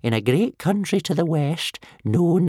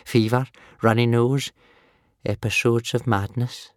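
put-on mildly gloomy old man, pitched up; gloomily; 4 semitones higher than usual. A narrator's reading. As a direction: list them with a touch of gloom. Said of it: "replied old Wrinkly gloomily".

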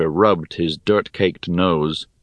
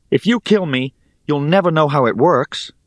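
raised-pitch defiant warning; tense bargaining; 7 semitones higher than usual. A narrator's reading. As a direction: warn the attacker with a sword at the throat. Hero bargains at sword point.

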